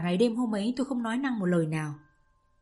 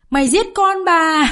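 screamed accusation, much higher and louder; cursing mother; 8 semitones higher, 10 dB louder than usual. Acted. Grieving mother screaming at her daughter's lover. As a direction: shriek it at him in grief and rage. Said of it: "cấu xé và chửi rủa".

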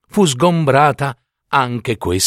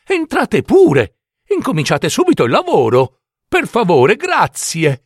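put-on higher-pitched, eager storybook girl; eager welcome; 6 semitones higher than usual. A narrator's reading. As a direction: usher the helpers in eagerly, pitch lifted. Girl eagerly welcomes her helpers. (invito premuroso, con voce più acuta)

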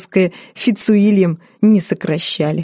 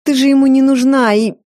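raised voice; 6 semitones higher, 4 dB louder than usual. A narrator's reading. Sister quarrel, raised voice.

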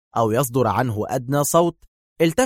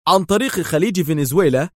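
tense; 6 semitones higher, 4 dB louder than usual. A narrator's reading. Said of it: "قال يائيل متوترا".

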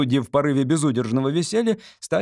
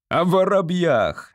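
high, drawn-out exasperated yell; furious outburst, stretched out; beside himself; 6 semitones higher, 4 dB louder, slower than usual. A narrator's reading. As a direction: wail it, beside yourself. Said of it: "вне себя завопил председатель суда".

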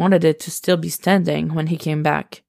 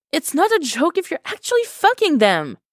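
much higher, rising hysteria; a bit hysterical; 12 semitones higher than usual. Acted. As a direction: let it tip toward hysterical. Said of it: "Her tone was turning just a bit hysterical".

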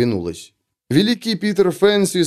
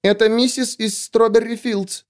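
put-on high squeaky four-year-old girl; squeaky little girl voice; 9 semitones higher than usual. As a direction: pipe it up like a tiny girl. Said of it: "писклявым голоском четырёхлетней девочки".

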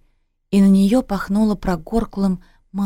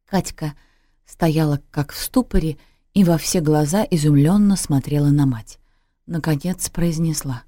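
usual speaking voice

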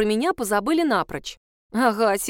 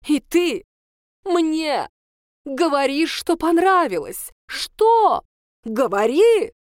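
high, loud shouted questions; furious demand; 6 semitones higher, 5 dB louder, slower than usual. Acted. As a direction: force an answer out of her. Teacher demanding answer. (яростно требует ответа)

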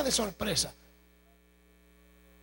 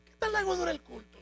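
naive question; 10 semitones higher than usual. Acted. Mock question from churchgoers.

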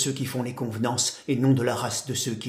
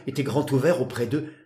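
ordinary delivery

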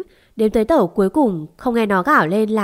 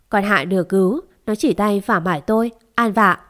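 plain reading voice

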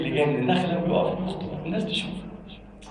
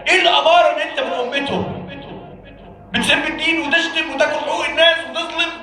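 heated preaching; 8 semitones higher than usual. Real, not acted. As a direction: challenge the listener like a stern preacher. Preacher scolds the faithful.

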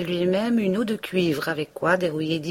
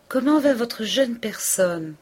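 higher, slow sweet query; Madame Homais asking; 8 semitones higher, 3 dB louder than usual. A narrator's reading. Madame Homais asking Emma about her baby, high and slow. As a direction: ask kindly.

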